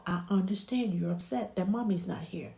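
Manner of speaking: soft, understanding comfort; quiet, steady reassurance; soothing reassurance. Shown as a demonstration. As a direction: gently acknowledge a baby's upset. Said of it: "Let them know".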